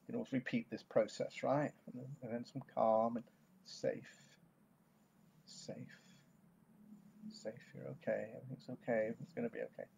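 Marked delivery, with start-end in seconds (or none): 2.7-9.9 very soft, spaced-out reassurance; calming. Shown as a demonstration. Coach showing how to end the technique, calming. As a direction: settle them with hushed, gentle words and long pauses.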